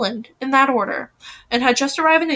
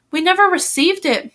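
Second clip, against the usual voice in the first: higher, mildly angry retort; narrated shout, only lightly raised; 6 semitones higher than usual. A narrator's reading. Mother rebuking Mimi, restrained angry shout. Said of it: "Mother shouted, turning red".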